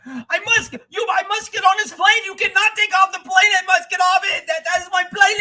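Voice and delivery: high, loud, frantic mock yelling; yelling traveler. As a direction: imitate a panicked man shouting at counter staff. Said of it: "yelling at the guy behind the counter".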